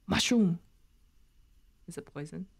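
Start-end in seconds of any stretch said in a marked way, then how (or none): 1.9-2.4 very soft, low-volume voice; hushed question. Real, not acted. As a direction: ask it under your breath, still voiced. Wary question about a mushroom.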